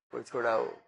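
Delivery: lightly voiced plea for help, falling; a hint of begging someone to untie him; mock plea. Bound man begging anyone to free him, mock plea.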